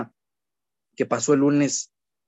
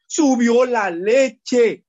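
slow, drawn-out louder cry of alarm; mock alarm; 7 semitones higher, 4 dB louder, much slower than usual. Preacher mocking worried parents, slow and louder. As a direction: wail it like the sky is falling. Mock outrage at milk prices.

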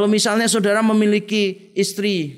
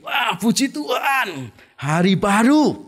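slow, higher, glad praise on waking; cheerful morning; 3 semitones higher, slower than usual. Acted. A believer wakes up and praises God, delighted. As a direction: greet the new day with delight.

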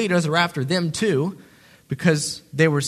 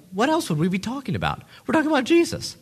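duh moment; 6 semitones higher, faster than usual. Retold or imitated. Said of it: "this duh moment".

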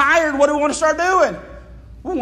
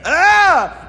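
dismayed gasp; 6 semitones higher, 5 dB louder than usual. Retold or imitated. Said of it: "And you're like".

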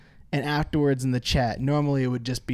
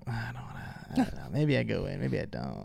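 much softer and lower hesitant voice; hesitant mumbling imitation; 6 semitones lower, 10 dB quieter than usual. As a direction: imitate an unsure player talking to themselves. Imitating hesitant players talking.

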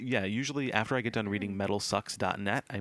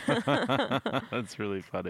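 laughing; 4 semitones higher, much slower than usual. Real, not acted.

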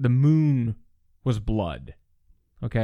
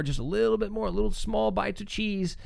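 mock flattering preacher; 7 semitones higher, 4 dB quieter than usual.

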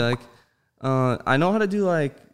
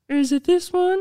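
put-on much higher, nerdy boy voice; Cleveland Junior impression; 4 dB louder than usual. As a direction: pitch it way up like a timid cartoon kid. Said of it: "Cleveland's, uh, son, Junior".

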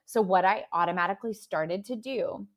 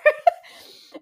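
loud high laugh; 12 dB louder than usual. Real, not acted. Laughing at her own outburst.

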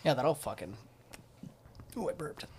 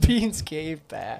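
slow, laughing repeat of the phrase; much slower than usual. Real, not acted. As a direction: draw it out through a laugh.

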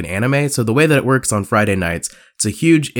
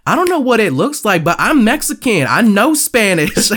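put-on sassy Brooklyn Puerto Rican accent; 10 semitones higher than usual. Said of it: "are you just giving her that accent".

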